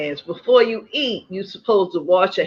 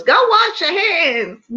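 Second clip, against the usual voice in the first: mother's command; 10 semitones higher than usual. Retold or imitated. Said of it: "my mother said was".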